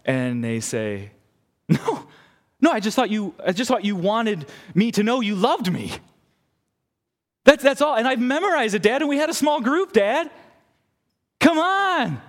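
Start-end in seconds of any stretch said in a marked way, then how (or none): 1.7-12.2 put-on high, loud whiny kid; a kid begging dad to let it slide; pleading child. Child dodges cleaning the room.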